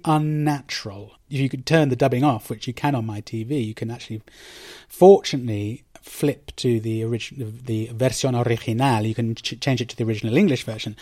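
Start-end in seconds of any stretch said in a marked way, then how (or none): none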